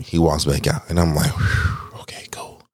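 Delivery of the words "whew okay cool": whispered sigh of relief; hushed, voiceless relief; relieved whisper. Retold or imitated. Relief as male therapist leaves.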